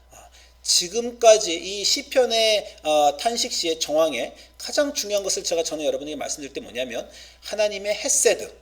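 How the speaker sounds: normal talking voice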